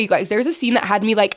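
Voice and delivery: ordinary delivery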